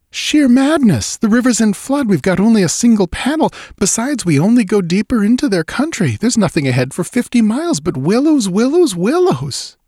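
high-pitched, agitated protest; fearful exclamation. A narrator's reading. Narrator protesting that escape by river is madness, fearful exclamation. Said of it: "shaking with fear after my hysterical outburst".

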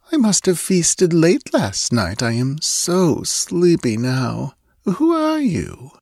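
slow, high, sleepy drawl; languid. A narrator's reading. Drowsy girl questioning the stranger after feasting, languid. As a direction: stretch it out as if too sleepy to care. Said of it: "she answered languidly".

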